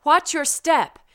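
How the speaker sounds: normal talking voice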